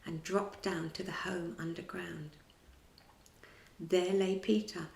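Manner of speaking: usual speaking voice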